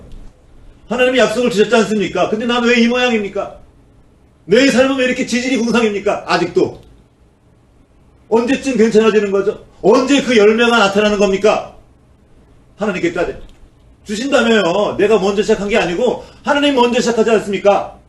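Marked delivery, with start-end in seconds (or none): 0.9-11.8 high, loud indignant demands; arguing with God. Acted. Believer arguing with God about unkept promises, indignant and loud. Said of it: "다시 따지는 거예요". 14.0-18.0 high, loud indignant demands; arguing with God. Acted. Believer arguing with God about unkept promises, indignant and loud. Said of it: "다시 따지는 거예요".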